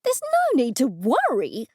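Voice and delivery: a woman screaming back the detective's reassurance; incredulous scream. A narrator's reading. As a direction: throw the words back at him in shock. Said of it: "I screamed".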